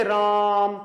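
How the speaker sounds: राम with the आ held long, as a pluta vowel; drawn-out vowel. Shown as a demonstration. Teacher calling out राम to show pluta, vowel stretched. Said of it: "जैसे राम का नाम पुकारते".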